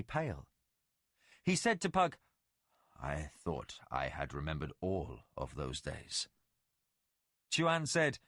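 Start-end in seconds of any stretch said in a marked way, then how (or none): 3.0-6.3 much lower, softer, solemn voice; ancient Valheru lord. Acted. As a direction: speak as a legend come to life. Tomas shaken.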